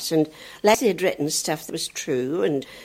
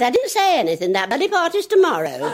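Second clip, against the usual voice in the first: put-on little boy; 7 semitones higher than usual. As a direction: say it like an innocent boy. Child voice punchline.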